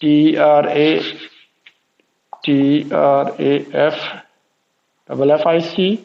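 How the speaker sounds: slow, letter-by-letter dictation; spelling out. Shown as a demonstration. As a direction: spell it out for a class, spacing the letters. Teacher spelling out a word.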